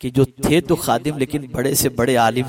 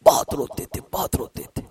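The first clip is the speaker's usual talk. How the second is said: hushed repetition. Acted. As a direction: let the words sink to a whisper. Describing the imam weeping. (سرگوشی میں دھیما لہجہ)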